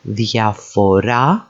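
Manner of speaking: diaphora slowly as thia-fo-RA; diaphora, slow, weight on the last syllable; stress on final syllable. Shown as a demonstration. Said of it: "takes the emphasis on the final syllable".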